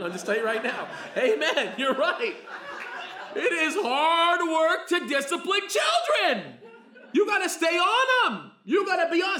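loud, high, laughing sermon shout; preaching at full force. Acted. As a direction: belt it out with a laugh. Fired up, laughing with congregation.

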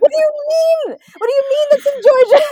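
high, loud, laughing disbelief; outraged Georgian laughing in disbelief; mock outrage. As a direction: shout it like you can't believe it. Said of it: "It's like".

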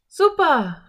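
put-on high, loud girlish cheer; excited girl. A narrator's reading. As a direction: call out with delight, pitched high. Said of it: "rief Anne".

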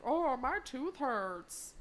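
exaggerated, slow toothache complaint; mocking whine. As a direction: drag it out like a mock sufferer. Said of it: "And then does an impression".

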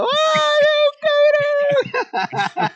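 fake-bawl "¡Ay, cabrón!" like you ran off crying; overdone mock sob of a grown man; mock wail. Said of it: "Sales llorando".

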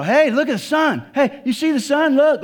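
high, loud mock excitement; excited. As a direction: call out like it's a thrilling discovery. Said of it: "like you're all excited about it".

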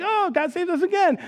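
high, sing-song relief; mock relieved Israelites. Israelites after crossing the sea, high mock relief. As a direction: play the fickle crowd sighing with relief.

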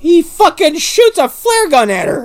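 high, loud excited outburst; almost yell it in disbelief; excited shout. Real, not acted. Excited retelling.

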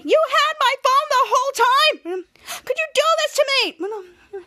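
high, slow, wailing outrage; hammed-up furious accusation, stretched out; mad. Chopper confronting Ray over the phone, acted fury. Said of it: "Chopper being so mad".

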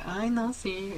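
sing-song high register, mock girly; light high mock-effeminate voice; high-pitched effeminate voice. Shown as a demonstration. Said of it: "yo también a veces hablo así como de".

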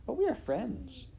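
high-pitched appeal; pleading princess. Acted. Princess appealing to Midge as a friend, high and pleading.

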